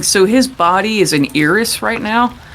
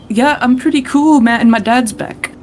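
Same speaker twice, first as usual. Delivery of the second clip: higher-pitched, upbeat reply; Jean; 7 semitones higher than usual. Acted. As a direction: pitch it up high for a pleased kid-like character. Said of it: "and Jean's like".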